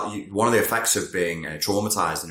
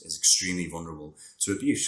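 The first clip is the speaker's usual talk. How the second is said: normal talking voice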